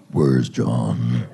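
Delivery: a deep, menacing father's demand; the girl's dad barging in, deep and menacing; angry dad. Retold or imitated. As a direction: boom it out like the furious dad. Dad bursts in hunting boyfriend.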